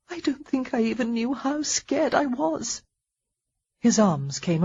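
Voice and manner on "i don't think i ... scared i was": high, trembling admission; tearful confession. Acted. Heroine confessing her fear to him, tearful. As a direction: still half in tears.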